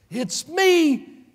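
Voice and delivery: high, loud cry of joy; joyful reveal. Acted. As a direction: burst out with the news. Joseph reveals himself.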